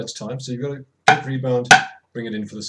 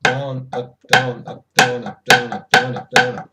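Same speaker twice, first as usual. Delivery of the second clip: louder chanted down up, stress on down; counting the motion; 7 dB louder than usual. Shown as a demonstration. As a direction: call the strokes in time. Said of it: "I'm playing on the down".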